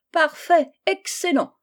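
high, exclaimed praise; princess's jubilant approval; delighted cry. A narrator's reading. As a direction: cry out in triumph. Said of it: "s'écria la princesse".

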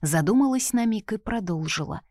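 ordinary delivery

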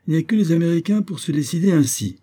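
high-pitched, lively astonishment; surprised exclamation. A narrator's reading. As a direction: exclaim at the unexpected news. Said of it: "il se récria sur l'imprévu de cette nouvelle". (s'exclamer avec surprise)

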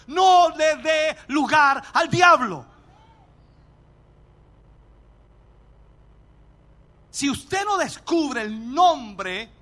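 loud and drawn out, every word weighted; booming deliberate pulpit warning; slow emphatic warning. Acted. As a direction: lay each word down slowly like a warning.

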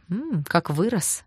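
dreamy admiration. Acted. Sister admires departing Danya.

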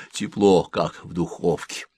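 plain reading voice

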